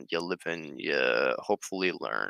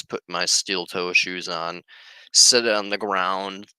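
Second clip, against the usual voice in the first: ordinary talk, nothing marked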